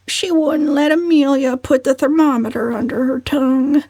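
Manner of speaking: high, mild whimper. A narrator's reading. Cousin Stickles complaining, a mild whimper. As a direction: whimper it pitifully. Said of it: "whimpered Cousin Stickles".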